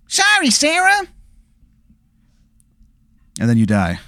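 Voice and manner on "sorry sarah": a very high, exaggerated bad Chicago accent; terrible Chicago accent. Distant wizard apologizes to Sarah in an awful Chicago accent. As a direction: ham up a dreadful Chicago accent. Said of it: "worst Chicago accent you've ever heard".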